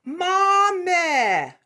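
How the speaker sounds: "Mame" with an open, pure eh, no glide; vertical meh vowel. Shown as a demonstration. Teacher modelling the vertical eh vowel, high. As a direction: sing "Mame" as a flat meh. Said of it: "now we're gonna go".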